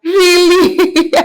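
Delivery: high, loud squeal of disbelief with a laugh; shocked disbelief. Retold or imitated. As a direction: gasp out the words as if you can't believe it. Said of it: "I'm like".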